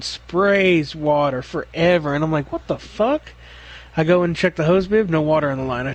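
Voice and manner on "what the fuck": higher, bewildered exclamation; a mock baffled cry at the hydrant; baffled what the fuck. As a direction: retell the moment of disbelief.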